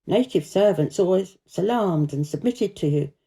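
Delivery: usual speaking voice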